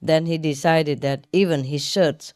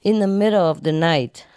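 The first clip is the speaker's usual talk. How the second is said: normal talking voice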